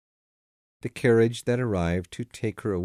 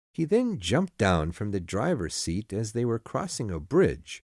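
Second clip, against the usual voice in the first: ordinary delivery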